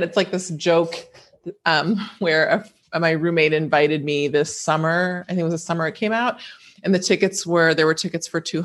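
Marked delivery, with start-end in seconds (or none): none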